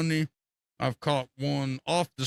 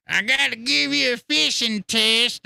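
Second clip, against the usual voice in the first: high, slow, louder mock-serious voice; imitating Josh; 9 semitones higher, 6 dB louder, slower than usual. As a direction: play the self-important fisherman laying down the rules, pitched up. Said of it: "That's what he told me".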